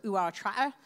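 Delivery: put-on Gloucester burr; lightly mock a Gloucester country accent. Woman explaining her mixed accent to the church, mock Gloucester accent. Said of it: "I was born in Gloucester".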